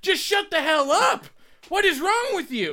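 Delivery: high, exasperated telling-off. Real, not acted. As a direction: scold them, fed up. Exasperated rant at bragging kid.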